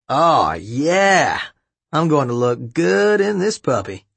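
show-off dad voice; playful, self-admiring dad; clowning dad. A narrator's reading. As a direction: ham it up as a dad showing off.

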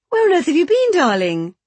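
loud, very high exclaimed question; exclaimed greeting. Acted. Mother greeting returning daughter, loud exclaiming.

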